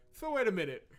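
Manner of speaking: loud, high-pitched working out of a confusing rule; exasperated. Real, not acted. Player can't figure out the red cards, loudly exasperated. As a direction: think aloud at full, frustrated volume. Puzzled, exasperated by game rules.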